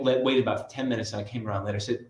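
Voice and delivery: ordinary talk, nothing marked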